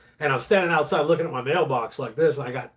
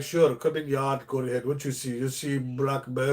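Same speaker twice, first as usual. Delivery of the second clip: lightly put-on Russian accent, a little quieter; a hint of a Russian accent, broken English; Russian neighbor accent; 2 semitones lower, 4 dB quieter than usual. Neighbor inviting him into the yard, mild Russian accent. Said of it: "that's how they talk".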